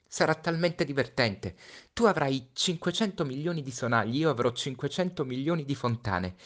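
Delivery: put-on a boy trying to comfort his friend; Little Prince, forcing cheer. Little Prince voiced in story. (Piccolo Principe, voce acuta, allegria forzata)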